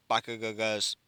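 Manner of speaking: ordinary delivery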